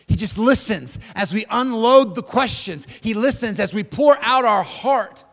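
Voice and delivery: swelling preacher's voice; rising fervor. Real, not acted. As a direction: lift the congregation with it. Preacher lifts the voice.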